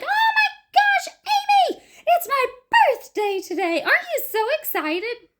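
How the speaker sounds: very high, loud excited squeal; wrong way: partner crying, squealing about her own birthday; oblivious excited squeal. Shown as a demonstration. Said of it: "I'm not really gonna run over and be like".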